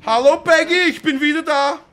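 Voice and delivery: loud, high overjoyed hello; hammed-up greeting. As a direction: burst in to greet her. (überschwänglich laute Begrüßung)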